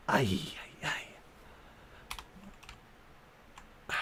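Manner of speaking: soft whispered sigh. Real, not acted. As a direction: breathe it out under the breath.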